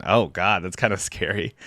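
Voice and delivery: usual speaking voice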